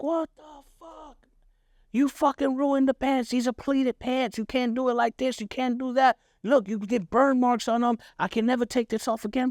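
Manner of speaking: high, raging father voice; a father yelling at his son over ruined pants; father's angry rant. Retold or imitated. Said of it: "Called me all types of names".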